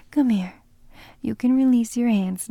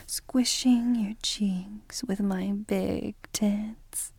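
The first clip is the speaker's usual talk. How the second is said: normal talking voice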